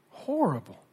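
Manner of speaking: Horrible pitched high and falling away; a pained, rising Horrible; pained cry. Real, not acted. Repeats horrible, higher.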